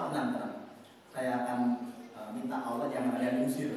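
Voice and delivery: low, quiet reassurance; self-assured soothing. Acted. Iblis offering to plead for the angels, low and calm. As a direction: promise help in a hushed, easy tone.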